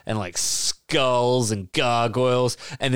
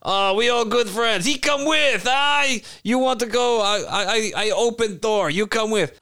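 put-on higher, broken English with a foreign accent; an excited accented dragon inviting friends; 7 semitones higher than usual. A dragon urging the player to bring the singer, excited foreign accent. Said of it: "That's a perfect dragon accent".

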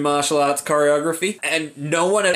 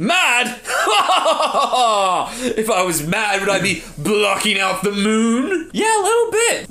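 mad villain; 6 semitones higher than usual. Acted. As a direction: laugh it off like a crazed villain.

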